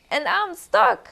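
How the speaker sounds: high, loud complaint; stuck little fox. Acted. Fox stuck in the mole's hole, high and loud. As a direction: whine that you are stuck.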